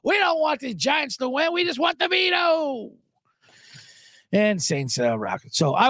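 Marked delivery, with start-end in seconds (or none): 0.0-2.9 high, shouted fan outrage; mock crowd yell. Fans yelling at the coach, high mock shout. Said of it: "And everyone's like".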